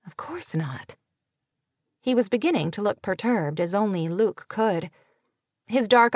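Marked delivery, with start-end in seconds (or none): 0.1-1.0 breathless whispered reply; whispering. Acted. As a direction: whisper it, barely voiced. Luke answers, hushed.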